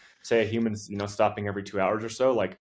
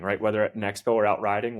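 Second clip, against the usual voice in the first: ordinary delivery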